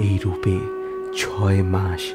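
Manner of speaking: usual speaking voice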